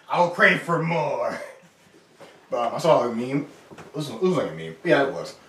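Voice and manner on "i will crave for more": high, giggly talk; laughing through words. Real, not acted. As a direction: keep laughing as you speak.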